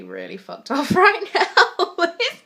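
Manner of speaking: slow, laughing through a curse; half-laughing frustration. Real, not acted. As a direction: laugh off the anger. Laughs at own anger.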